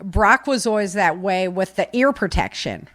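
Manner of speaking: ordinary delivery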